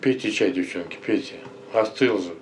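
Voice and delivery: ordinary talk, nothing marked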